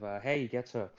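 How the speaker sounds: usual speaking voice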